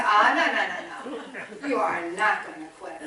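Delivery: retold firm no, starting loud; firm refusal. Mother refusing to let her son quit, firm refusal. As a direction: put your foot down, half amused.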